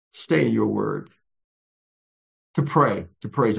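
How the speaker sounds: low, hushed-to-firm praying voice with long pauses; earnest prayer. Real, not acted. Preacher asking Father to help us stay in the word, earnest low prayer. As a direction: pray it low, pausing between phrases.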